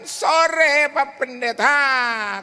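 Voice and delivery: put-on high, drawn out old lady; lightly voiced elderly widow; old woman caller. Widow answering the pastor, high and slow.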